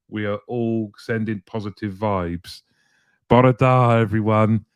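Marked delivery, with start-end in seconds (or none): 3.3-4.7 hearty Welsh greeting. Real, not acted. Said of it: "The Welsh legend is here".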